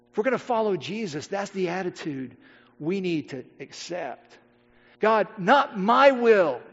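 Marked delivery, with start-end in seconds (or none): 5.0-6.6 slow, deliberate declaration at full voice; slow earnest prayer. Acted. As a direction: give each word its full weight. Preacher voicing the prayer himself.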